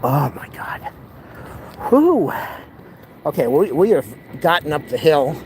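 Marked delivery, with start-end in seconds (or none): 1.9-2.3 high puffed-out whew; relieved whew. Real, not acted. Vlogger at the top of the hill, high relieved whew. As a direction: blow out with relief. Hot and tired after the hill.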